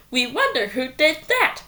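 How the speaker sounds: ordinary delivery, nothing marked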